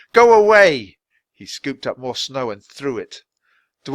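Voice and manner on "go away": high, loud shout. A narrator's reading. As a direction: drive the wolves away with a yell. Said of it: "he shouted".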